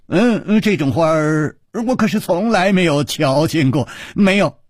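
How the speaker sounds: shaking head. Acted. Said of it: "摇着头说".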